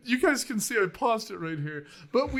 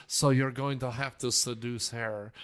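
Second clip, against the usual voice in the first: put-on low-pitched seducer with a French accent; slightly French Lothario; 7 semitones lower than usual. Podcaster imitating an actor's Lothario in a low French accent. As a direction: lean into a little French lechery. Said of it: "he has to be a little French".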